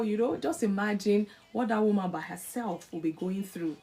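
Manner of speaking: ordinary delivery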